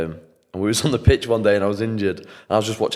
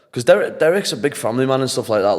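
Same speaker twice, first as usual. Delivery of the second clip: ordinary talk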